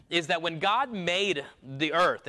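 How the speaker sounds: normal talking voice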